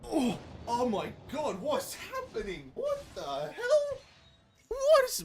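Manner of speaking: very high, incredulous squeal; stunned disbelief. Real, not acted. Streamer asking what's happening after lucky pulls, high stunned squeal. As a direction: climb into a squeaky, laughing panic.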